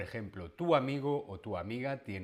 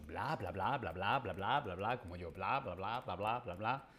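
low, soft bla bla babble; rapid nonstop chatter; 4 semitones lower, 4 dB quieter than usual. Shown as a demonstration. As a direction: endless chatter, softly. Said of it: "una persona que habla mucho". (parloteo sin parar)

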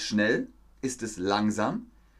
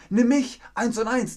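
high, loud pleading sales voice; a provider begging a customer to choose it, high and pleading; eager provider; 11 semitones higher, 4 dB louder than usual. Pretend. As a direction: beg to be picked, high and eager.

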